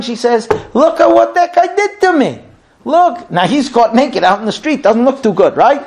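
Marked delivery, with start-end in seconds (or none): 0.7-3.3 mock accusation. Wife accusing Yosef to household.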